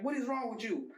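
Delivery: high, exasperated imitated question; imitating old man. Grandpa answers the screaming kid, imitated high protest. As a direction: play the appalled grandpa, pitch jumping up. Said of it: "He's like".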